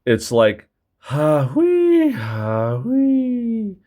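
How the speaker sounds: squeaky whistle snore sounds; whistling snore. Shown as a demonstration. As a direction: make a rising whistling snore. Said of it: "Other times".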